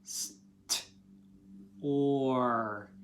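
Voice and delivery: St- whispered then or, split into sounds; sounding out. Shown as a demonstration. Teacher sounding out store in parts. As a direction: break store into st and or. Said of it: "Pause it and try it".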